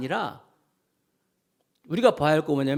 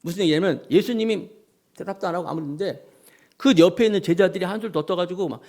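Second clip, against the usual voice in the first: normal talking voice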